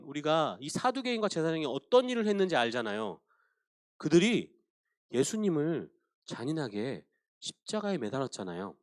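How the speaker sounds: ordinary talk, nothing marked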